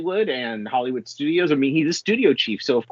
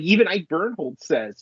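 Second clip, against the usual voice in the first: normal talking voice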